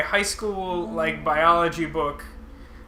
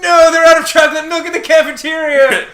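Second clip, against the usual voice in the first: high, loud, hammed-up wail; mock outraged shout; 10 semitones higher, 12 dB louder than usual. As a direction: yell it like a cafeteria tragedy. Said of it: "usually the response was just".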